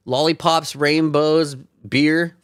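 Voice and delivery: high, bright sing-song sarcasm; sarcastic cheerful list; mock cheery. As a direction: list them like a kid's fantasy. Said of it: "mock cheerful list".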